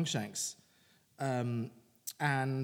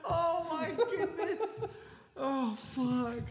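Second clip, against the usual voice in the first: high, slow words through real laughter; helpless laughing; 11 semitones higher, much slower than usual. Real, not acted. Co-host recovering from Trina's punchline, helpless laughing. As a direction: gasp it out while still cracking up.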